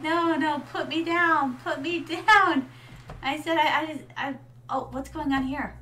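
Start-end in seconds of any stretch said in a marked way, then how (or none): 0.0-2.7 higher, louder retold pleading; mock protest. Streamer re-enacting being carried after a knock, laughing protest. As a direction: protest loudly, half laughing. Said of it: "I'm like".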